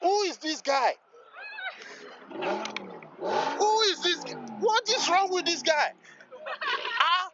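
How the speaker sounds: high, amazed repeated question; incredulous excitement. Real, not acted. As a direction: marvel at the revving car. Reacting to loud exhaust.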